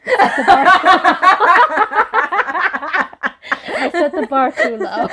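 helpless laughter breaking up the words; real giggling fit, words stretched by laughs; laughing hard. Real, not acted. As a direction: crack up while trying to talk. Cracking up at own challenge.

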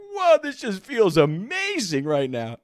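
high, loud, slow wonder; drawn-out mock ecstasy; blissful amazement. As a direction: act out being blown away by pleasure. Reliving overwhelming pleasure.

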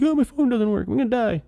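high-pitched mock teen whine; put-on high voice, hammed-up despair; teenage daughter. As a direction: imitate a panicked teenager's melodrama. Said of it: "And she's like".

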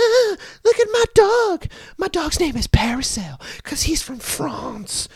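high, breathy posh-lady mock with a whispered tail; mock the snobby dog owner; bougie lady voice. Imitating the bougie lady with her tiny dog. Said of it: "and she was like".

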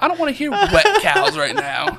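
high, loud retort breaking into laughter; mock outrage, laughing; laughing exasperation. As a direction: laugh through a fed-up protest.

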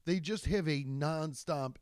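ordinary delivery

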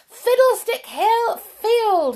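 very high, slow, loud squeal; delighted shout. A narrator's reading. Jump sighting their station, delighted shout. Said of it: "he shouted".

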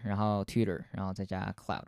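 usual speaking voice

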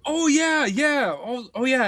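high, loud sudden remembering; oh yeah, it clicks; sudden recognition. Real, not acted.

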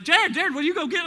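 high, loud nagging wife; mock wife calling her husband, high and loud. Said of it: "She's like".

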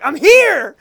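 high loud I'm here; hammed-up Luke arriving; mock heroic shout. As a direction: burst in late like a hero. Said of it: "He's like".

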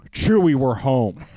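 low, slow movie-hero line; Harrison Ford. Acted. Podcaster quoting a film to his co-host, Harrison Ford impression. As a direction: deliver it slowly, down in the register. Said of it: "gonna do a Harrison Ford impression".

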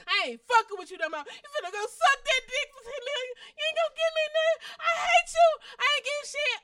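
high, loud, fast tearful shouting; mock heartbroken rant. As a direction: ham up a crying, betrayed girlfriend. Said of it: "So I'm supposed to say".

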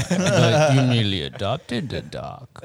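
loom over him like a masked villain; Bane impression. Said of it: "I bring up the Bane quotes".

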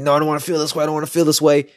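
high, whiny protest against the feeling; resisting. Retold or imitated. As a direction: refuse the feeling like a stubborn child. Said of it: "It was just so resistant".

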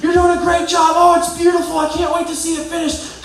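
high, loud cheering shout; yelling encouragement. Retold or imitated. Graham yelling encouragement at the workers on the statue. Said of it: "Graham starts yelling out".

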